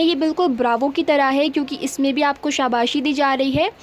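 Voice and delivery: usual speaking voice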